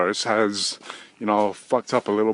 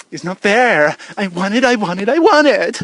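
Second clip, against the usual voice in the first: put-on higher and louder, whining like a spoiled child; mock tantrum; 6 dB louder than usual. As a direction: build into a childish fit. Childish tantrum about belts.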